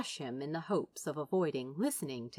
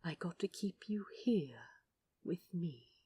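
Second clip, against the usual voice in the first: soft near-whisper; gentle whispered angel; 6 dB quieter than usual. Acted. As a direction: hush it gently, like a confession.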